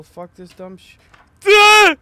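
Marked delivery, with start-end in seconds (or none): 1.5-2.0 sudden shriek of fright; startled scream. Real, not acted. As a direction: yelp at a jump scare. Jump scare in horror game.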